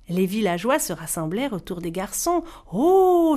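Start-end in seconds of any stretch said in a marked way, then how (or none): 2.7-3.3 high, loud gasp of wonder; be struck by the beauty; amazed exclamation. Acted. Villager seeing the cloth, amazed. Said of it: "s'exclama un homme".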